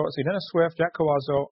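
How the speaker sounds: usual speaking voice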